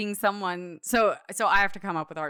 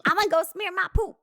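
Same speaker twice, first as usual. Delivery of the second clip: high fast comic voice; 10 semitones higher, faster than usual. Pretend. Joining the clown impression.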